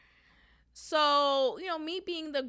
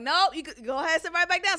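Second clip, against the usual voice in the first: mock command; 5 semitones higher, 5 dB louder, faster than usual. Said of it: "I'm like".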